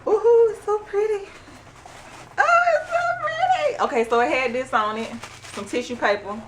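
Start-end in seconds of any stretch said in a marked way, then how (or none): 0.1-1.3 high, delighted coo over the mug; gushing delight. Real, not acted. 2.4-3.8 very high, thrilled squeal; squealing delight. Real, not acted. Gushing over unboxed mug.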